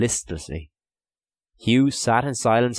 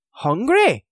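high, loud disbelieving echo; incredulous; 6 dB louder than usual. A narrator's reading. Donal cannot believe his cellmate's appetite, incredulous. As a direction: throw the word back in disbelief. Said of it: "said Donal's voice incredulously".

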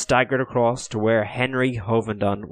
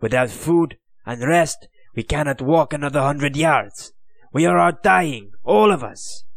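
pleading, rising higher and louder to the end; worn-out colonel begging for his men; desperate prisoner; 6 semitones higher, 4 dB louder than usual. Acted.

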